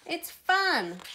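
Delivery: high, swooping enthusiasm; excited, high-pitched urging; eager call. A narrator's reading. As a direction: call it out with childlike glee. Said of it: "called Peppa".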